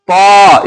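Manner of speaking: "पा" as a heavy t with a long rounded aw, "taw"; thick तॉ. Shown as a demonstration. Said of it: "इसको मोटा करके बोलना है". ("पा" को मोटा "तॉ")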